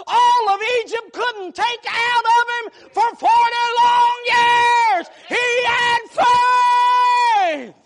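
loud, high, drawn-out preaching wail; whooping preacher at full cry; preaching at full force. Real, not acted. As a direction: holler it like the climax of a sermon.

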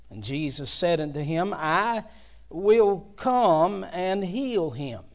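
solemn Bible reading; scripture reading. Acted. Reads the scripture text aloud.

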